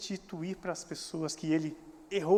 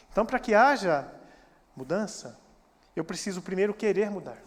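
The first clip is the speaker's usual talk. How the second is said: ordinary talk, nothing marked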